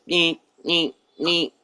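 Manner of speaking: high mock electronic beeps; phone beeping imitation. Teller mimicking his locked phone's alarm beeps. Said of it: "like, doing this".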